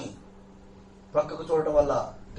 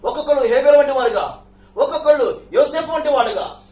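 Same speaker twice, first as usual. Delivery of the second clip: higher and louder, pounding each name; full-force preaching; 6 semitones higher, 8 dB louder than usual. Acted. Preacher rallying the Kuwait church, loud fervent preaching. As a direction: preach it big, rolling through the list.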